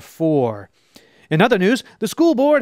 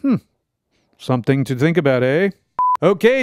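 ordinary talk, nothing marked

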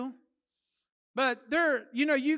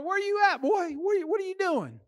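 high-pitched mock dad demanding answers; scolding dad; 9 semitones higher than usual. Dad pulls up in the car to his boy, mock scolding. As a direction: play an exasperated dad calling his boy.

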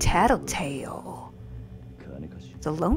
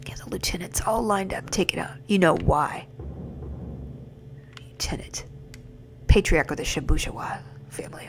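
whispering the game dialogue; whispered read; 7 dB quieter than usual. Acted. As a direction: read the lines in a hush. Reading game dialogue hushed.

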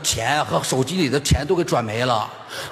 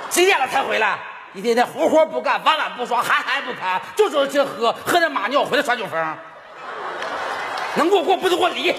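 higher-pitched nagging wife; scolding wife; 6 semitones higher than usual. Acted. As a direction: tear into the drunk husband. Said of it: "这家伙，这是我媳妇儿".

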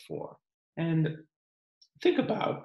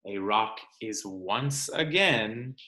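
put-on reading to children; 3 semitones higher than usual. Teacher reading the rock book to her class, storytime narration. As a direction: read it aloud for the class.

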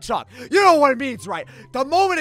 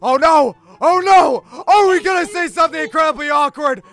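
loud panic; 4 semitones higher, 8 dB louder than usual. Acted. Panics as bottle lands.

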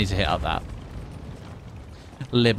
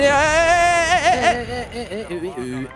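calling out; 14 semitones higher, much slower than usual. Real, not acted. Calling at game enemy.

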